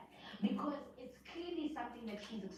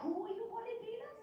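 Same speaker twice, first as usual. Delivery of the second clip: mock indignant; 11 semitones higher than usual. Mocking the castmate's line.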